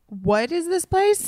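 high, slow, appalled visitor; mock bewilderment. Imitating newcomers to a small hometown, bewildered. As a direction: stretch it out in high disbelief.

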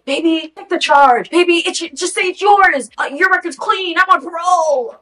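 high, urgent wheedling; mock pleading boyfriend. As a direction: beg like a cornered boyfriend, hammed-up. Voicing pleading criminal boyfriend.

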